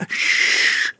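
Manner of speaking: unvoiced shush. A narrator's reading. Henfrey hushes Missus Hall to hear the window. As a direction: hush her at once.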